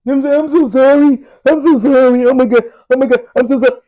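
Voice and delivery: high, loud, whiny fake apology. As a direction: ham up a terrified crybaby begging not to be hit. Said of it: "Then they'll come and say".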